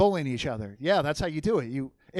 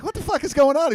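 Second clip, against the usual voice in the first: confused shock; 11 semitones higher, 9 dB louder than usual. Retold or imitated. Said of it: "I'm like".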